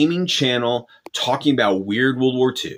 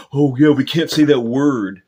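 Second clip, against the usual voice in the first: mocking voice. Mocking compliant creators.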